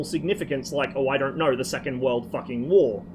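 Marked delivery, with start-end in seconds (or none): none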